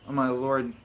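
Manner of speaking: ordinary delivery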